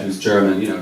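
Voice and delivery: ordinary talk, nothing marked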